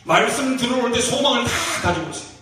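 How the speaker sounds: normal talking voice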